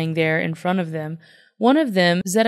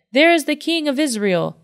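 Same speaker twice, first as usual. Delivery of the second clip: narrated shout; 7 semitones higher than usual. A narrator's reading. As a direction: call it out as a reader would, pitched up. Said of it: "they shouted".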